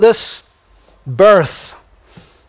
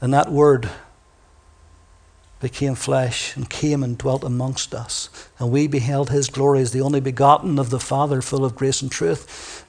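softer, solemn scripture read; 3 semitones lower, 4 dB quieter than usual. Acted. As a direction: read the verse low and reverent. Reads John verse fourteen.

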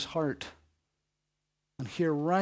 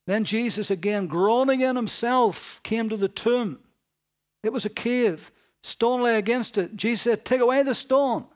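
louder, higher, rising sermon drive; preaching at full force; 6 semitones higher, 8 dB louder than usual. Acted. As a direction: build the story to its climax. Preaching climax.

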